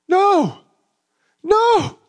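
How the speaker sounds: very high, breaking no; overwhelmed dad. Retold or imitated. Father overwhelmed by the gift, very high and tearful. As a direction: squeak out a tearful no.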